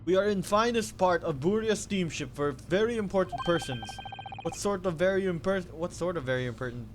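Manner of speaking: put-on loud, boastful Russian accent; Russian sailor accent. Sailor boasting about the first class area, Russian accent. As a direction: puff up like a guard in Russian accent.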